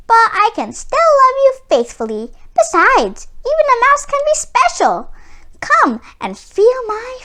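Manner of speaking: high, quick mouse voice; sweetly persuasive small creature; little mouse. A narrator's reading. Mouse wooing Miko, high and eager.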